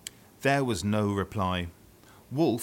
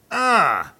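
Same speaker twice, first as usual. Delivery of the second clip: startled ah; 12 semitones higher, 14 dB louder than usual. Acted. Wolves see the muscly granny.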